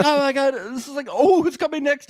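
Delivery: high, jittery imitation; nervous energy. Sophia wondering who's coming next, mock nervous energy. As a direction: mimic an excitable, nervous babbler. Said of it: "She had such nervous energy".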